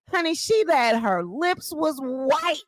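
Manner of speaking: ordinary talk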